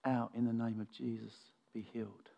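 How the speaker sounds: soft, understated healing command; hushed, unforced authority; quiet command. Acted. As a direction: say it calmly, dropping almost to nothing. Acts Jesus quietly commanding healing.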